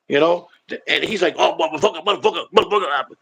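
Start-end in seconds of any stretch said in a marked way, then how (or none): 1.4-3.2 run-together mumbled cursing, imitating a friend; imitating friend's swearing. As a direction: mimic a buddy's habitual string of cussing. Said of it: "And that's how he talks".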